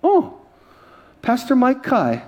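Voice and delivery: high surprised recognition, slow; mock thrilled recognition; starstruck lady. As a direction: gush like a starstruck fan. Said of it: "She looks".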